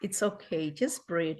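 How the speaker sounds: slow, soft, letting each phrase settle; soothing echo. Acted. Host echoes the guest's billboard words, slow and soft. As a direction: repeat the words gently, as if calming a friend.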